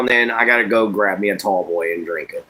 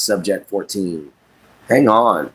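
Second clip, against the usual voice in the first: normal talking voice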